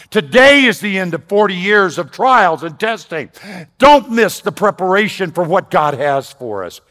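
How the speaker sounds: loud, high, driving exhortation; rally the church on its anniversary; preaching at full force. Acted. Preaching climax.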